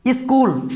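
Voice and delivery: स्कूल said as "is-kool"; leading "is" sound. Shown as a demonstration. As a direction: add "i" at the front of स्कूल. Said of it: "यहाँ पर भी इस हो रहा है".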